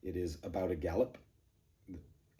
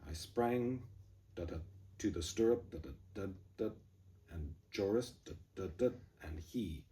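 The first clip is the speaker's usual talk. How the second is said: da da beat between phrases; scanned with da's; 3 dB quieter than usual. Shown as a demonstration. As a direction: chant the meter in da's. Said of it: "in the first line".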